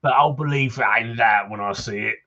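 put-on townsman in a Cockney accent, gruff and chatty; grumbling Cockney local greeting a stranger; Cockney NPC. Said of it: "for the accent compliment".

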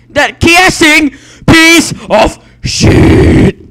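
loud, high, slow raging yell; furious shout. Real, not acted. Gamer raging at an enemy who killed him, furious yell. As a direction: scream at the enemy player.

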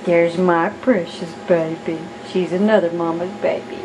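ordinary talk, nothing marked

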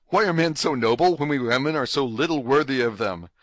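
ordinary delivery, nothing marked

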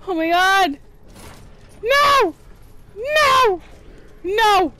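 high, loud frightened no's; panicked cries. Real, not acted. Gamer screaming while the monster grabs her, panicked. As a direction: shriek in real fear. Attacked in horror game.

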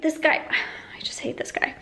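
soft unvoiced whisper with a sigh; whispered grumble. Muttering to herself about the guardian, sighing whisper. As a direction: hiss it under your breath, fed up. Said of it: "And I was like".

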